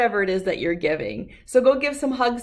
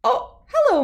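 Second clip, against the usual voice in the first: a high, delighted oh hello; light up with relief; surprised high greeting; 6 dB louder than usual. Acted. Little T-Rex finding his mother, high and delighted.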